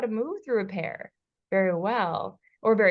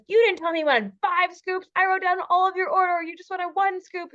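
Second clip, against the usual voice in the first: high, louder defensive mock protest; super defensive; 9 semitones higher, 5 dB louder than usual. Imitating how she could snap back at her husband, high defensive whine. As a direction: bristle and justify yourself, hammed up. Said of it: "I could get super defensive".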